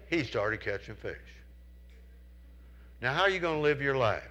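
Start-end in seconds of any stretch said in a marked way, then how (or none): none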